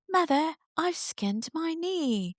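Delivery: high, drawn-out theatrical wail to mother; hammed-up dramatic child. Child in from playing to mother about skinned knee, hammed-up drama. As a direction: play a melodramatic kid, pitched high and slow. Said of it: "dramatic to the core".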